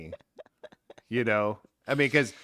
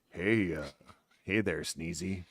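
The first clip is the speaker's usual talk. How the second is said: low, soft creepy come-on; murmured pick-up line, pitched low; creepy flirting; 7 semitones lower, 7 dB quieter than usual. Acted. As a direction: play a sleazy guy sidling up quietly. Imagined bald guy hits on her.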